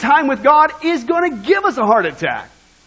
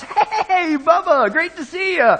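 higher-pitched mock shout of greeting; Tom Cruise shouting; 6 semitones higher, slower than usual. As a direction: call out a drawn-out hey to a friend. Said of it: "Tom Cruise, he shouts".

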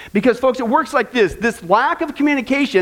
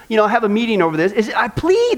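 normal talking voice

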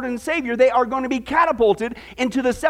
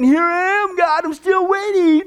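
higher sing-song taunt; 6 semitones higher, 3 dB louder, slower than usual. Retold or imitated. Professor taunts God a third time, smug mock taunt. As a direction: needle God, smug and mocking. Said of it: "the professor taunted God again".

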